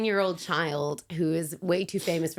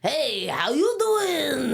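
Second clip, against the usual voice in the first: put-on slow, higher hammy Chicago accent greeting; 7 semitones higher, much slower than usual. Guest faking a Chicago accent on the phone. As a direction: ham up a Chicago "how you doing". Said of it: "meant to be Chicago".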